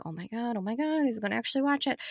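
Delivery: hushed inner squeal. Retold or imitated. Wife hushes her excitement beside husband, softly. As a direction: whisper your delight so he won't hear. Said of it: "So I'm like".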